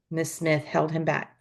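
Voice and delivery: plain reading voice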